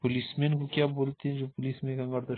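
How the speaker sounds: usual speaking voice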